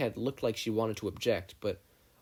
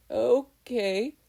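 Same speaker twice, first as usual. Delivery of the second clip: much higher, louder squeak; nervous squeak; 14 semitones higher, 7 dB louder than usual. A narrator's reading. Izuku answers the girl, squeaking nervously. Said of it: "he nervously squeaked out".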